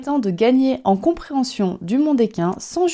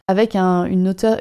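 ordinary delivery

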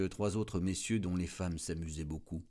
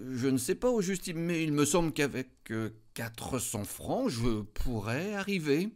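higher, slowing to a halt on the price; hesitant; 8 semitones higher, 5 dB louder than usual. A narrator's reading. Wife names a price to her husband, hesitant and high. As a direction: weigh each word as if afraid of the sum. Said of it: "elle répondit en hésitant".

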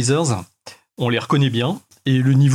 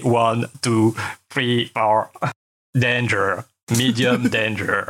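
imitating a robotic game voice; lightly voiced robot countdown; 3 semitones lower, much slower than usual. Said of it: "Avec une voix robotique super énervante".

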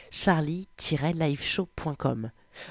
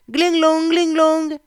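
much higher, louder ding-dong imitation; doorbell chime; 10 semitones higher, 6 dB louder, slower than usual. Narrator imitating the entrance bell ringing, high chime. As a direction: sing out the doorbell ring.

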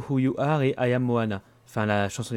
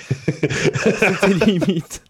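laughing; 7 semitones higher, 5 dB louder, much slower than usual. Real, not acted. Laughing at friend.

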